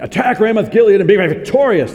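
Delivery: loud, high rallying cry; proclaiming prophets. Retold or imitated. Four hundred prophets urging the king, loud rallying call. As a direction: cheer the king into battle.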